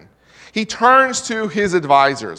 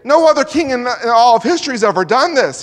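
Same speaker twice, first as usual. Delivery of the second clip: higher, louder alarmed protest; alarmed advisors; 6 semitones higher, 6 dB louder, faster than usual. Retold or imitated. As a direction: cry out that no king ever asked this. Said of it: "they say".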